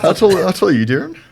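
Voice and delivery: high laughing tease. Real, not acted. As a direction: laugh while asking. Teasing laugh at friend.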